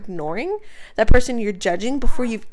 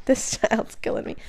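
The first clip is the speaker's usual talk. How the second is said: breathy laugh through the words, softer; laughing helplessly; 5 dB quieter than usual. Real, not acted. Mother laughing as the baby interrupts her point. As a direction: laugh it out, fond and exasperated.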